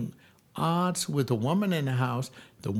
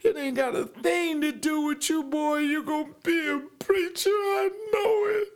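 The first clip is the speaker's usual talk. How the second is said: grandma crying and insisting. Retold or imitated. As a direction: push it out through tears, climbing higher. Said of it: "And she would cry".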